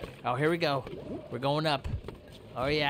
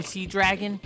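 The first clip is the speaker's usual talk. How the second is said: normal talking voice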